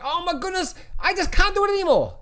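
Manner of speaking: high, whiny imitation of a despairing investor; mock panicked seller. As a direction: squeak it out like someone caving in. Mocking panicked sellers giving up.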